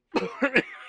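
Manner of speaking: sudden cough on waking; acted cough. A narrator's reading. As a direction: cough as if just jolted awake. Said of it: "He coughed".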